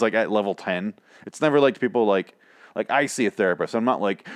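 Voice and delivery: ordinary talk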